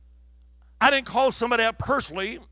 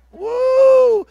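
high, loud drawn-out "woo"; Pentecostal whoop; 13 dB louder than usual. Real, not acted. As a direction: let out a piercing church whoop. Preacher whoops at church fanaticism.

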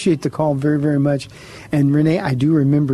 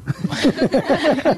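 amused chuckle; 10 semitones higher than usual. Real, not acted. Pastor teasing silent boy.

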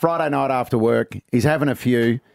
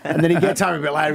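ordinary talk

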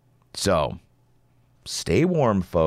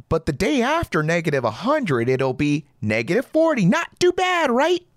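mock optimism; 7 semitones higher than usual.

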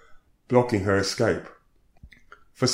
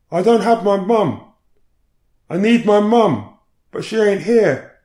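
distressed pleading; 11 semitones higher, 5 dB louder than usual. Retold or imitated. Distressed woman wanting her mum.

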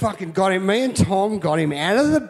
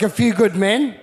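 ordinary talk

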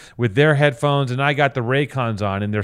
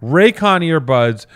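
hyped ad read; 5 dB louder than usual. Pretend.

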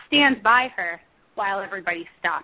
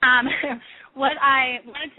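breathless, laughing it off; out of breath; 4 semitones higher than usual. Real, not acted. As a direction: still winded, catching breath. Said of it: "out of breath from the trip up the stairs".

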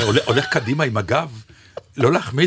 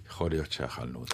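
low mutter; 12 semitones lower, 12 dB quieter, faster than usual. Retold or imitated. Voicing the room's doubtful buzz.

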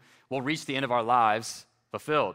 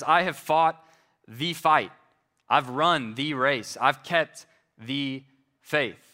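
slow solemn quote; slower than usual. Shown as a demonstration. Paul's words repeated, slowed down.

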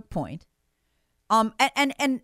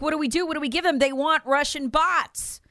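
higher, mock-panicked questions; 6 semitones higher than usual. Imitating panicked Twitter staff asking what to give the FBI, mock panic. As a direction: play the flustered staffer, pitched up. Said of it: "And they're even panicked".

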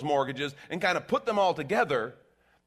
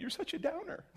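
mock whiny listener; 13 semitones higher, 8 dB quieter than usual. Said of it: "Well, not when you say it like that".

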